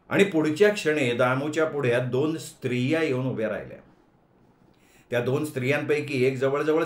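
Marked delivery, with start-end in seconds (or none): none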